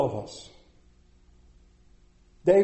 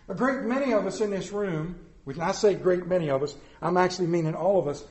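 ordinary talk

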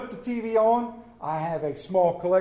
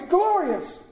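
"glorious" pitched much higher, falling at the end; soaring rapture; 10 semitones higher than usual. Acted. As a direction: top the first one with joy. Repeated rejoicing, higher take.

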